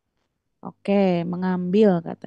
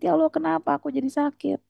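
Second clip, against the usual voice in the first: higher, quicker plaintive plea; mock complaint to God, pitched up; acted plaintive lament; 7 semitones higher, faster than usual. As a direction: imitate someone bemoaning illness to God. Acted lament of the sick person.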